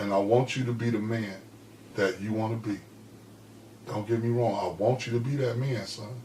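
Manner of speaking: a mother speaking low and steady to her son; calm quiet mother. Retold or imitated. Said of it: "when a woman talking to you calm, cool, and collected".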